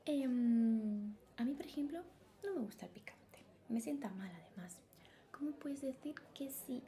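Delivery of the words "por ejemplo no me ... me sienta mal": ordinary talk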